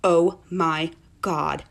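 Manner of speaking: slow, stretched oh my God in dawning horror; slow horrified realization; drawn-out dread. Retold or imitated. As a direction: let it sink in, dragging each word. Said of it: "I was like".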